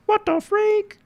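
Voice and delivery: very high squeal of surprise; shocked. Real, not acted. Shocked at the art.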